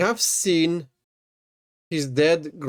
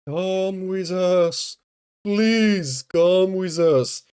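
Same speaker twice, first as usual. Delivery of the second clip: slow, higher, drawn-out spirit voice beckoning the dying; mock ghost calling; 5 semitones higher, much slower than usual. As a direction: lure them like a hammed-up ghost. Dead relatives beckoning the dying.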